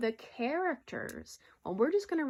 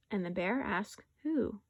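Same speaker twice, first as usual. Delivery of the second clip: animated, expressive story narration; picture-book read-aloud; 2 semitones higher than usual. Acted. Teacher reading a rhyming bear story to pupils, animated storytelling. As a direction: read it lively to young children.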